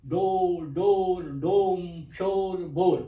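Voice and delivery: each word said out slow and loud; demo words. Shown as a demonstration. Teacher modelling the O sound to students, slow and loud. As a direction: model each word, clearly and loudly, for the class. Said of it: "इनमें ओ की आवाज आ रही है".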